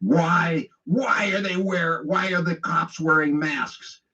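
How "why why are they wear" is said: ordinary talk, nothing marked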